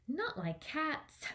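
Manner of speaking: high, indignant, slow cry; a small creature's shrill outrage; shrill and passionate. A narrator's reading. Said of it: "in a shrill and passionate voice".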